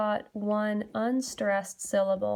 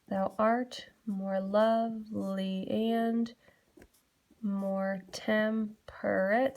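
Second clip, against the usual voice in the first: "Thou art / more love / ly and / more tem / perate", very slow and split up; broken into metric feet; much slower than usual. Shown as a demonstration. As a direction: draw out "Thou art more lovely and more temperate" foot by foot. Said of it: "I literally do that for all".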